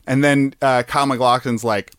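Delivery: usual speaking voice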